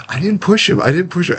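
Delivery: high-pitched imitated denial; mock protest. Castmate retold disowning the couch fall, mock protest.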